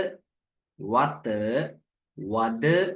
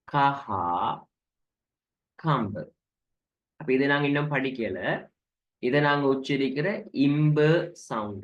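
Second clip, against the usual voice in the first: ordinary talk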